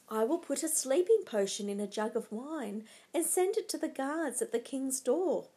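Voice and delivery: usual speaking voice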